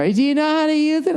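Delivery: the waitress offers help with the iPad in a high, mock-sweet voice; helpful waitress. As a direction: chirp it up high like a cheerful server. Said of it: "the lady comes over".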